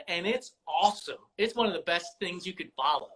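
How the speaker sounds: impassioned fan, pitched well up; passionate. Real, not acted. Basketball fan defending women athletes' smack talk, passionately. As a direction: argue it with real passion, voice riding high. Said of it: "Clearly, I'm passionate about this".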